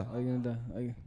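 ordinary talk

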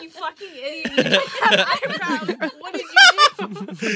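loud, slow, laughing mock yell of an angry mom; hammed-up angry mom shouting through laughter. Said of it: "my mom's like".

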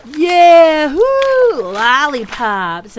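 a crowd of crocodiles cheering; cheering shout. Acted. Crocodile chorus shouting for lollipops, loud whooping cheer. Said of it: "A whole chorus of voices shouted".

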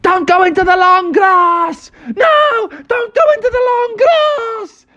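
very high, squeaky panicked yell; high-pitched cartoon alarm; yelling baby possum. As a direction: shriek a warning as a baby animal. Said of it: "started yelling out".

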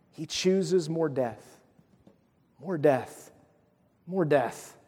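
a grieving, quiet refrain; slow heavy repetition. Real, not acted. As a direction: let the repeated words sink, heavy and slow. Repeated lament over kings.

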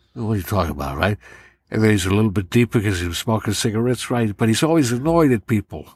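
New York tough guy, annoyed and barely talking; Robert De Niro. Shown as a demonstration. Impressionist building the voice step by step, annoyed tough-guy impression. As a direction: brood like a smoker with a scrunched-up nose. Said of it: "Robert De Niro".

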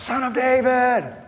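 high, drawn-out call to a passing crowd; crying out. Retold or imitated. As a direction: call out slowly, high and long as if across a road. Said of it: "He's crying out".

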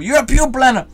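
high, loud accusing yell; accusing shout. Retold or imitated. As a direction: hurl the accusation at him. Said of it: "As the waiter was accusing him".

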